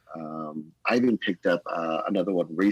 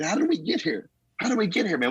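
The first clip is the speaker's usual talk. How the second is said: rising higher in disbelief; amazed wonder; 12 semitones higher than usual. Retold or imitated. Pastor marvelling at the packed Sunday crowd, amazed wonder. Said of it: "I'm thinking".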